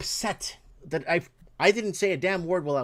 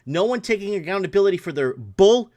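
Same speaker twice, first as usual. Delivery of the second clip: louder, biting complaint; angry outburst; 3 semitones higher, 6 dB louder than usual. Real, not acted. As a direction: spit the last word out in real anger. Furious rant about insurance office.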